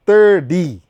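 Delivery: "thirty" said loudly as ther-DEE. Shown as a demonstration. Teaching American words to viewers, "thirty" with a D. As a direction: put a punched D in place of the t in "thirty". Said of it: "weka D kwa hiyo T".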